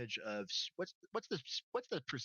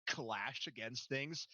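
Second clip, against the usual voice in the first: ordinary delivery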